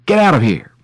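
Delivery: high, loud storyteller's yell; narrated scream. A narrator's reading. Gardener chasing off the poor woman, screaming. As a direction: bellow it briefly, still in storytelling mode. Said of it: "he began to scream at her".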